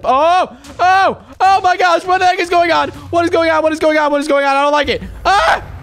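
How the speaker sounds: scared rising yells; panicked. Real, not acted. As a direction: panic as things go wrong. Scared by creepy scene.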